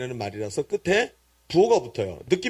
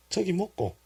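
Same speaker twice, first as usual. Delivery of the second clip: quick, softer Gyeongsang dialect repeat; 2 semitones higher, 6 dB quieter than usual. Shown as a demonstration. Preacher repeats the answer for congregation, in Gyeongsang dialect. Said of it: "경상도 사투리로 하면 네 글자가 나와요".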